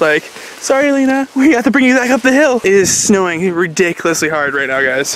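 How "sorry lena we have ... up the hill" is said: put-on talking to toddler. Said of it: "she gets angry at us".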